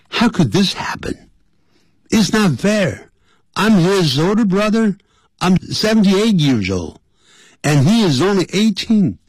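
high-pitched, aggrieved complaint; outraged older brother, high voice; indignant protest. Acted. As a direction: protest the unfairness, pitched well up. Acting older brother's protest in story.

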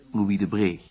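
ordinary talk

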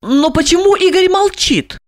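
high female voice of surprise; puzzled, high question; suddenly surprised. A narrator's reading. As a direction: wonder aloud, pitched high. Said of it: "вдруг удивилась Ольга".

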